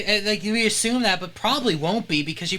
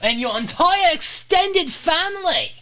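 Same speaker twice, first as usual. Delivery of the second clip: high, loud, hammed-up Scottish rant; loud Scottish accent; 14 semitones higher, 5 dB louder, slower than usual. Streamer's brain overriding the Australian attempt, mock-furious Scottish accent. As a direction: yell it in an exaggerated Scottish accent, drawn out. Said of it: "my brain was like".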